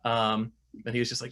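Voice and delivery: ordinary talk, nothing marked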